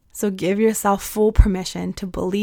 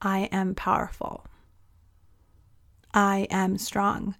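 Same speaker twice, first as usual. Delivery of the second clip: soft slow affirmation; 7 dB quieter, slower than usual. Shown as a demonstration. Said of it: "And say the words".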